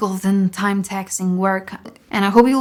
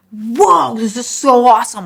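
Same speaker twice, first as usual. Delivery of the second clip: amazed; 3 semitones higher, 6 dB louder than usual. Retold or imitated. Amazed in cold water.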